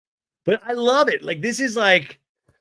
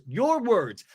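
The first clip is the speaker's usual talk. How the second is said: higher, emphatic imitation of anger; mock accusation; 6 semitones higher, 3 dB louder than usual. [name] confronts Tamara about her words, mock indignation. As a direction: lay out the charges indignantly.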